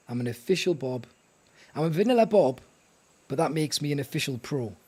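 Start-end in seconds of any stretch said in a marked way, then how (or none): 1.7-2.6 proud declaration. Real, not acted. Proud boast, repeated claim.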